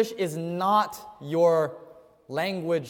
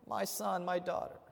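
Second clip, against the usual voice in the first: softer, tender mother's call; a mother calling her child, sweet and soft; 12 dB quieter than usual. Retold or imitated. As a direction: say it the way a loving mom calls her child. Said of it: "in that sweet tone of voice".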